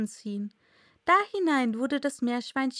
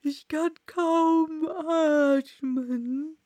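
very slow, drawn-out higher creature voice; klagendes Murmeltier; 5 semitones higher, 4 dB louder, much slower than usual. Acted. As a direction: drag out every word as a complaining Dormouse. Sleepy Dormouse complains of being squeezed. (klagende, langgezogene Murmeltierstimme)

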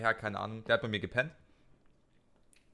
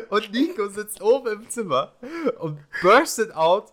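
laughing while telling; 10 semitones higher, 6 dB louder, slower than usual. Real, not acted.

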